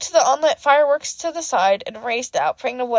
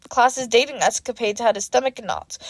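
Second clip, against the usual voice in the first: ordinary delivery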